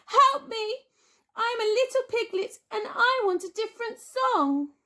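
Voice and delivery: put-on high, loud childish wail; mock wail. A narrator's reading. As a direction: wail for a different song. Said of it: "Crispin screamed and cried".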